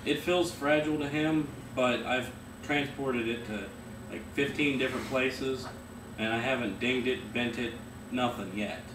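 talking around hookah mouthpiece; speaking between hookah puffs; hose at lips. Real, not acted. Seen 0: Gesturing man in plaid holds hose mouthpiece at his lips throughout.

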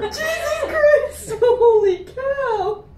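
high, drawn-out startled cry; shrieky amazement at a huge surprise; real shock. Real, not acted. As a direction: squeal it in laughing disbelief. Startled by the big snake.

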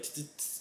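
unvoiced tss of a flickering light; flickering light hiss. Mimicking the lights flickering in the storm, unvoiced hiss. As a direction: hiss out the flicker sound softly. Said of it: "It was like".

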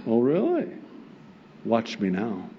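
ordinary talk, nothing marked

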